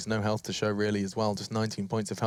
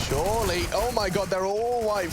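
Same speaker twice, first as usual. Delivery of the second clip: excited; 10 semitones higher, slower than usual. Real, not acted. Caster hype, CTs flashed.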